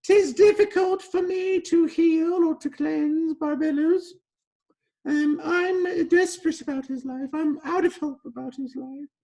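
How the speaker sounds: put-on very high, loud theatrical accent; desperate plea in a put-on high-pitched accent; Petro, changed accent. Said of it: "why Petro's, uh, accent changed there".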